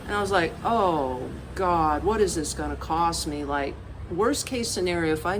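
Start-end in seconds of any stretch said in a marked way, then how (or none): none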